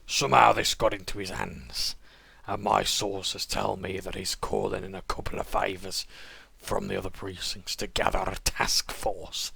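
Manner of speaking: loud, slow, menacing crime boss; smug sewer kingpin with a Dudley accent. Acted. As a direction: lean in like a gangster offering a deal. Sewer King monologue continues.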